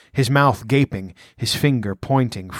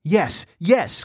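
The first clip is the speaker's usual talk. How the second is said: higher, agitated agreement; pitched-up, anxious cry; flustered cry; 6 semitones higher than usual. A narrator's reading. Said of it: "cried Publius".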